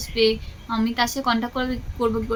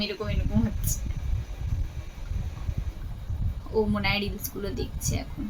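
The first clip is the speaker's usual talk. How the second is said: hushed aside; 2 semitones lower, 5 dB quieter than usual. Real, not acted. Telling viewers the friend caught on, hushed. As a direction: lean in and confide to viewers. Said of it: "aside to viewers during prank".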